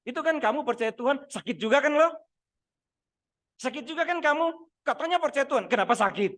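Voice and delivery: high, loud mocking taunt; devil's taunting voice. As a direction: sneer at the believer like the devil. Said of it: "Itu iblis bisik begitu". (suara iblis mengejek dengan nada tinggi dan keras)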